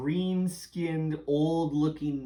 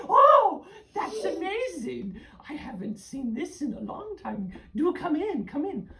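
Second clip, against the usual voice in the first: witch voice; 13 semitones higher, 5 dB louder than usual. Acted. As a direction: coo like a hag luring guests. Witch invites them in.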